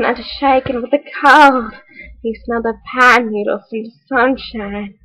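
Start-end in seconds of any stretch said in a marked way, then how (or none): none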